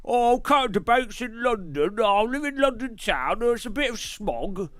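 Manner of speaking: over-the-top Cockney geezer; mock London accent. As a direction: play a cartoon Londoner. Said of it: "This is a London accent".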